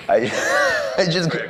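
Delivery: helpless giggle between the words; laughing. Real, not acted. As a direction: laugh at your own naive mistake. Laughing at his own mistake.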